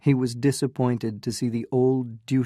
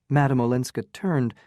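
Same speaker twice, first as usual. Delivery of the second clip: ordinary delivery, nothing marked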